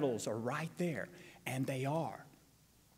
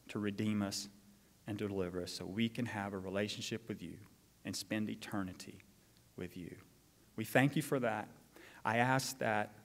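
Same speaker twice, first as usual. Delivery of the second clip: prayer; 7 semitones lower than usual. Acted. Pastor leads congregation in prayer.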